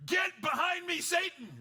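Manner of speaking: high, loud shouted command; Jesus shouting at Peter, hammed-up; shouted rebuke. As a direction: blast him away. The rebuke moments later.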